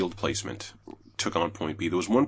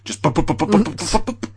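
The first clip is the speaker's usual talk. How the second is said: imitated gunshots; 8 semitones higher, 5 dB louder, faster than usual. Imitating gunfire.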